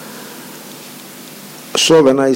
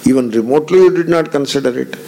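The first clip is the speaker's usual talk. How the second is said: normal talking voice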